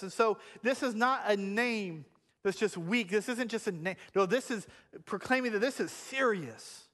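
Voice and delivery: usual speaking voice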